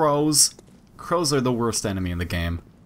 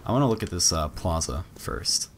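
normal talking voice

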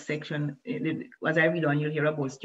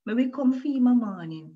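lightly voiced girl, higher and louder; Ariane's voice; 6 semitones higher, 6 dB louder than usual. A narrator's reading. Ariane telling Moshe's mother she will fetch him, lightly voiced. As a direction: speak for her simply and kindly. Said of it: "in Ari Ariane's voice".

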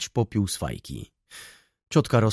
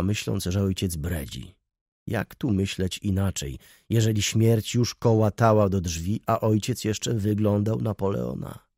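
plain reading voice

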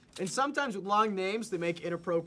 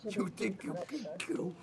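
menacing villain taunt; dark lord; 7 dB quieter than usual. Pretend. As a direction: taunt like an evil overlord. Playing the villain snowman.

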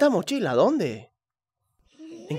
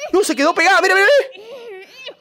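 alarmed whine; 12 semitones higher than usual. Real, not acted. Streamer to chat as it sticks, a high alarmed wail. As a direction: cry out as the thing sticks fast. Object stuck, panicking.

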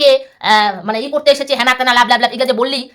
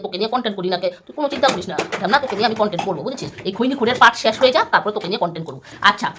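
ordinary talk

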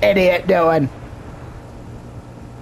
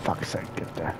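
frustrated mutter; 14 dB quieter than usual. Real, not acted. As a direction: sigh it out, fed up with the game. Frustrated at the game.

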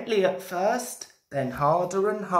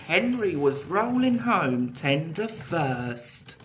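usual speaking voice

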